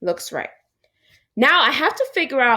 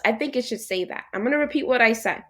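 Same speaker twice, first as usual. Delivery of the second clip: ordinary delivery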